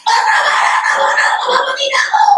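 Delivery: loud, high, fast screaming plea; terrified scream. Acted. Woman frightened half to death begging it to stop, screaming high and fast. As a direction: scream for mercy as if about to die.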